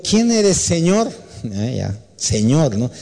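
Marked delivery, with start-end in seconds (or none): 0.0-1.1 awed question. Acted. Saul asks the voice.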